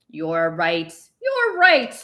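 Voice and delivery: mocking repeat rising to a loud angry jab; wife angrily mocking her husband's excuse. A narrator's reading. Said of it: "Liliana said angrily".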